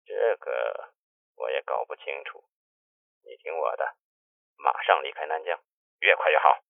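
hushed, guarded phone advice; voice growing smaller. A narrator's reading. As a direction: sink the voice down as you urge him away. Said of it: "二哥的声音越来越小".

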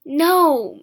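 emphatic shouted no; horrified cry. A narrator's reading. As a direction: refuse at full voice.

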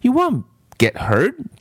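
high, insistent reassurance; coaxing boy voice, pitched high; insisting boy. Acted. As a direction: urge her not to worry. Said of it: "Damon insisted".

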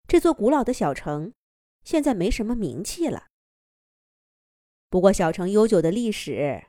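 ordinary delivery

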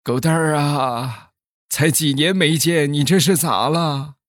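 put-on high, slow, tearful old uncle voice; old man Li pleading with emotion, voice high and slow; choked-up old man. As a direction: play an old villager choking back tears. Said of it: "李大爷哽咽着就说".

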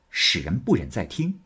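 usual speaking voice

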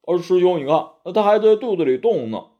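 lightly voiced anxious Bajie; Bajie pleading. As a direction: fret like a pregnant pig demon. Bajie frets about his belly.

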